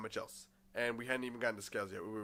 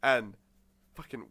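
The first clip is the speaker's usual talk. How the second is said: ordinary talk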